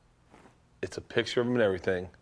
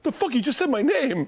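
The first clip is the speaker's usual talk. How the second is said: high mock-astonished child; imitated stunned boy; 13 semitones higher than usual. Boy hears Santa say his name, high and stunned. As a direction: blurt it out in pitched-up disbelief. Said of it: "Max Frompkin would be like".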